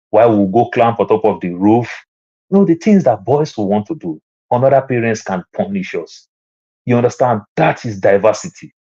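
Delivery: ordinary talk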